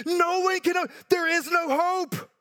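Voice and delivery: anguished outcry. Acted. Despair over the unopened scroll.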